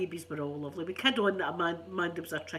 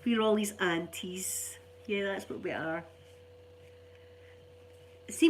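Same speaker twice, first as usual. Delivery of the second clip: ordinary talk, nothing marked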